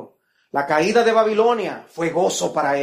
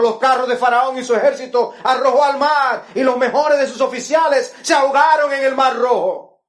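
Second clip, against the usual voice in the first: loud, high preaching of the scripture; thundering pulpit reading; fervent preaching; 6 semitones higher, 5 dB louder than usual. Acted. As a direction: proclaim the verses with fire. Preacher reads Exodus at full force.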